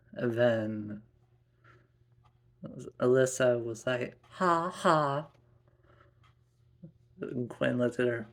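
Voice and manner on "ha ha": high mocking "ha ha"; taunting child. Little girl teasing her scolded sister, taunting. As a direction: gloat in a sing-out tease.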